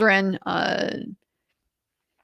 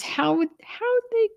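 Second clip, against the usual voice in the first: much higher, small imitation of a stunned wife; tiny voice; 13 semitones higher, 3 dB quieter than usual. Narrator voices the wife questioning her husband, high and tiny. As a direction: ask it high and tiny, stumbling. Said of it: "her tiny little".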